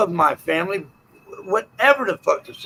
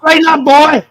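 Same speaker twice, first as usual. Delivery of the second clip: much louder, higher stern command; barked order; 6 semitones higher, 8 dB louder than usual. Real, not acted.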